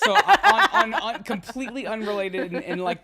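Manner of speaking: high, loud laughing words. Real, not acted. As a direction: talk through the laughter. Laughter after the group joke.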